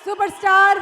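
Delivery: loud, drawn-out star introduction; announcer. Real, not acted. Host welcoming Mahesh Babu to the stage, announcer shout. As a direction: hail the star at full voice, stretching words.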